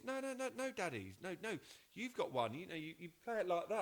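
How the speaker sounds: put-on high insistent child voice; high child pleading "no, no, no"; child insisting. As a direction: insist like an eager little kid. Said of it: "He goes".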